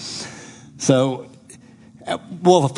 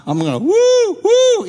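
high, loud gleeful "woo"; triumphant whoop; 6 dB louder than usual. Retold or imitated. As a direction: cheer it like the fight is won. Celebrating imagined win.

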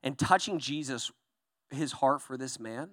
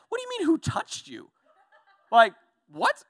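very high, disbelieving squeal with a laugh; mock the question in a high voice; incredulous disciple; 8 dB louder than usual. Disciples' incredulous reply acted.

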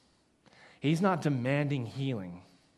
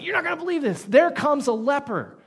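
much higher, louder, breathless news; excited announcement; 9 semitones higher, 7 dB louder than usual. Retold or imitated. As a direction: announce it like unbelievable gossip. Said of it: "It's like".

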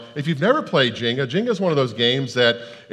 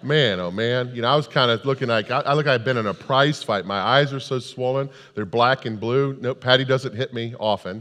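ordinary talk, nothing marked

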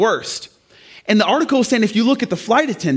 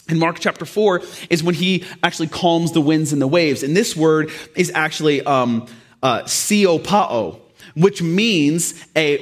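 ordinary talk

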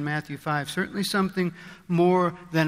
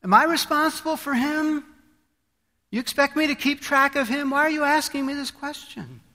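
higher, indignant protest; defiant Cain; 9 semitones higher than usual. Acted. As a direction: fire back as an offended Cain. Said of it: "He says".

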